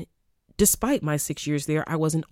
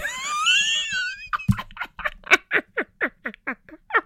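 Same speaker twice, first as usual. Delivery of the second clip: high squealing laugh. Real, not acted. Laughing after the song.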